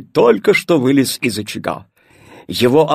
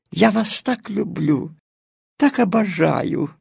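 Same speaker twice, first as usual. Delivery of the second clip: purring cat; 5 semitones higher than usual. Acted. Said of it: "промурлыкала она".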